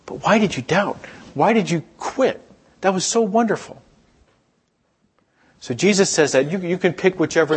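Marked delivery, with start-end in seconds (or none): 0.1-3.8 high-pitched, affectionate coaxing; gently puzzled but full of admiration; encouraging, loving. Acted. Jesus asking Peter why he quit, loving encouragement. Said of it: "that's the way I read it".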